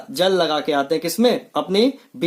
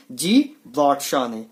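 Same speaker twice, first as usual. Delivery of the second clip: slow, lower drill answer; 5 semitones lower, slower than usual. Shown as a demonstration. Teacher answering his scooter quiz slowly. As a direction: drag the answer out so it sticks. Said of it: "तो scooter की खोज किसने की?".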